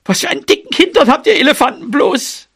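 high, quick scolding mouse; indignant complaint. Acted. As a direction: grumble at high pitch and speed. Limping mouse scolds the elephant. (empört und schnell, mit hoher Stimme)

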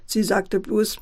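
ordinary delivery, nothing marked

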